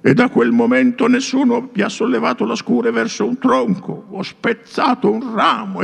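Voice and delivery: put-on high, solemn old man's voice; old priest warning the feasting prince. A narrator's reading. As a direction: warn like an aged priest. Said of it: "e disse il vecchio".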